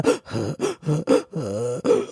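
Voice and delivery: slow, laboured gasps, acted out; drawn-out imitated last breaths; imitated dying gasps. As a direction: mimic a dying man's breathing. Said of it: "how he was breathing".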